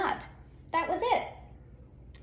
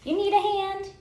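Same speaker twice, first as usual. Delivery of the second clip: kind offer; 9 semitones higher, 3 dB louder than usual. Acted. Cool bean offering help.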